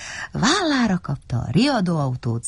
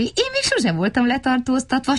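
high, pleased small voice; high-pitched childlike glee; delighted; 9 semitones higher than usual. A narrator's reading. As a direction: pipe up happily about the novelty. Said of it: "örült Vacska Matti".

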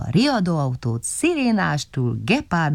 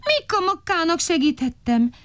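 whining; 14 semitones higher than usual. A narrator's reading. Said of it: "siránkozott Vacska Matti".

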